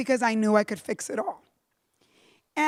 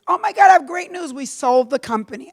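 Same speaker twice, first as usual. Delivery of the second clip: excited lawyer; 2 semitones higher, 7 dB louder than usual. Retold or imitated. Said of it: "the lawyer says".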